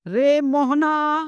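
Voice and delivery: very slow call, final vowel held long; prolonged call. Shown as a demonstration. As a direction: hold the last vowel of Mohana like a far call. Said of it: "जैसे रे मोहन".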